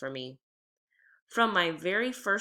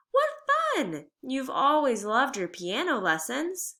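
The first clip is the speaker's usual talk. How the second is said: bright, much higher burst of delight on what fun; delighted mother; 6 semitones higher than usual. Acted. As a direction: gush like a thrilled mother cheering her daughter on. Mother cheers about accordion.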